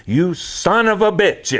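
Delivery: put-on high, slow, angry old man with an Italian accent. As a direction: curse him out in a thick Italian accent. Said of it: "in this Italian accent".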